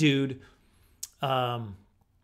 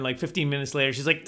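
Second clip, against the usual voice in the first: ordinary talk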